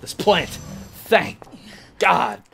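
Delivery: loud, punched-out words of relief; relieved shout. Real, not acted. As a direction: yell it out in triumph. Plant dies, relieved yelling.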